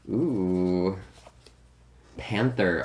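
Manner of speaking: ordinary talk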